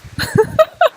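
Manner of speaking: high, loud peal of laughter; helpless high-pitched giggle; real laugh. Real, not acted. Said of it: "I'm laughing".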